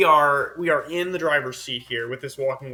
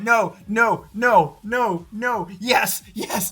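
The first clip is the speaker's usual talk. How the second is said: shouting no, no, then yes, yes, higher and louder; retold excited shout; 6 semitones higher, 3 dB louder, much slower than usual. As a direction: replay the moment of panic turning to triumph. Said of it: "I wish I had the recording because I went".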